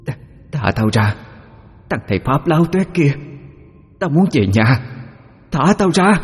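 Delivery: put-on high, piercing Chinese-accented possessed voice; Chinese-accented ghost voice. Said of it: "giọng lơ lớ đặc trưng của người Hoa, ồm ồm, xen lẫn the thé".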